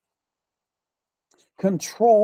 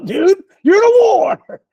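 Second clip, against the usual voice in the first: high, loud preaching outburst; emphatic shout; 12 semitones higher, 10 dB louder, slower than usual. Real, not acted. Preacher telling the wounded they're at war, emphatic shout. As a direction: punch it out at full force.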